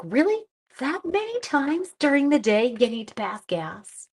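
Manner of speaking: high-pitched incredulous questioning; mock disbelief. Teacher doubting students' many gas breaks, mock disbelief. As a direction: question them as if you can't believe it.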